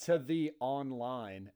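slow, drawn-out mimicked phrase; teasing, stretched-out quote of a co-host; quoting her slip. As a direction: repeat her odd phrase very slowly. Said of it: "when you had said".

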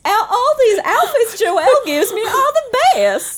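high, slow Southern drawl; hammed-up Southern accent. Celia praising her outfits, high hammed-up Southern accent. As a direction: gush in a comic Southern accent, laughing. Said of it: "she has plural accents".

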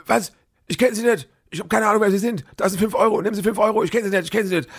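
high, hurried panicky protest; flustered denial. Retold or imitated. Accused woman in queue denies knowing her, high flustered panic. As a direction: squeak out the denial, pushing money away. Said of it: "Und da sagt die andere".

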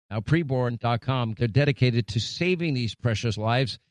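low, even radio ad read. Acted. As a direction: read the ad smooth and steady like a radio pitchman. Host reads sponsor ads.

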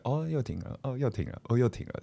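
flat, quiet, unimpressed; bored resigned sigh. Retold or imitated. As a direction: say it like you expected it. Fans' bored reaction imitated.

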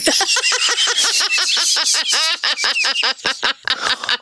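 long, high burst of laughter. Real, not acted. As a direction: laugh hard at your own joke.

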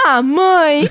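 little son's exasperated protest; Valentim. Retold or imitated. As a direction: lightly mimic a boy complaining to mom.

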